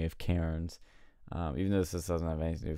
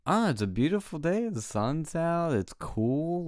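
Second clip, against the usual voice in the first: high, lilting, drawn-out protest; high singsong retort to the lady; retold cheery reply; 9 semitones higher than usual. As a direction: sing the praises of the day, pitched up. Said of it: "I'm like".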